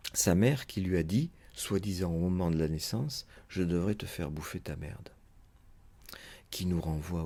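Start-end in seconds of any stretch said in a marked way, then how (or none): none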